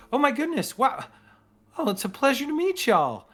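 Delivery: put-on high, sweet young cactus lady; Petal. Petal meets the party at her granddad's ceremony, in a high sweet voice. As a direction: gush warmly at the newcomers. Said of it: "she goes".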